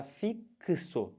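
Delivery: "fixo" slowly split as "fik-so"; slow sounding out. Shown as a demonstration. Teacher shows both sounds of X, slowly sounding it out. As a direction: stretch "fixo" apart, both k and s heard. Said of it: "Você fala".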